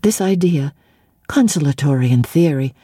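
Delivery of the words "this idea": usual speaking voice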